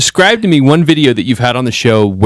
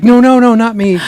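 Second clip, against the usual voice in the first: much higher, flustered protest; shy wife; 10 semitones higher than usual. Retold or imitated. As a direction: wave off the camera, embarrassed. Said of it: "She's like".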